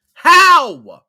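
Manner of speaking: loud high disbelieving How; incredulous how. Real, not acted. Gamer reacting to toxic subreddit, loud disbelieving outburst. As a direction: blurt it out in disbelief.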